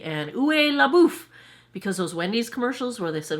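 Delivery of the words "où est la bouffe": mock French. Shown as a demonstration. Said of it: "all I remember how to say is".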